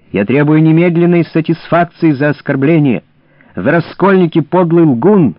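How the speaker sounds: high, loud, gasping challenge; breathless shout. A narrator's reading. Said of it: "задыхаясь и кашляя, крикнул Шрам".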